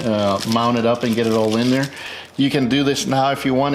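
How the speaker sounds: ordinary delivery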